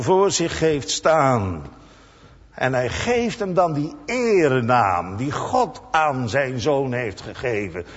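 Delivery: ordinary talk, nothing marked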